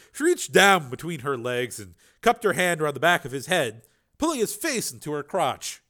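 usual speaking voice

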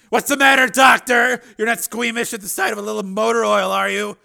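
loud mocking shout; angry taunt. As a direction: jeer at her, furious. Taunting her in anger.

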